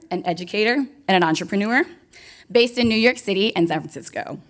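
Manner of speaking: ordinary delivery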